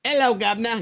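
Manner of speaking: put-on playful stage Cockney accent; mock Cockney. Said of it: "I like the piratey accent".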